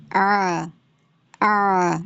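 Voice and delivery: آ squeezed and pushed inward from the mid throat; آ constricted, throat tight; pushed-in ain vowel. Shown as a demonstration. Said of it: "اندر کی طرف آپ کو آواز کو push کرنا ہے".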